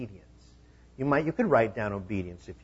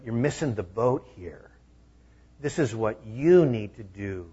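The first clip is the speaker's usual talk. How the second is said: ordinary talk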